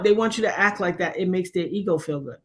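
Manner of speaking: usual speaking voice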